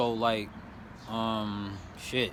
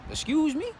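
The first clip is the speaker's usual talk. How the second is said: challenging excuse me; 12 semitones higher than usual. Retold or imitated. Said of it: "I'm like".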